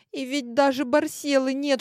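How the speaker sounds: ordinary delivery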